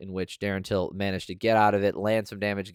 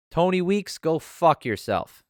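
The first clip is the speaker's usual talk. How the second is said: angry outburst; 8 semitones higher, slower than usual. Real, not acted. Praising one judge, cursing another.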